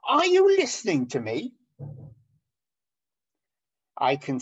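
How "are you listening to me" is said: loud, high scolding question; teacher or parent telling off an inattentive child, loud and high; stern teacher. Retold or imitated. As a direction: scold a daydreaming pupil. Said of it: "your parents saying to you".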